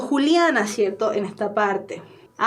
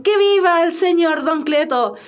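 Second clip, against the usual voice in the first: slow, higher-pitched hurrah; celebratory cheer; 4 semitones higher, 4 dB louder than usual. Acted. Miguel cheering don Cleto after approval, celebratory. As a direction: proclaim it like a toast.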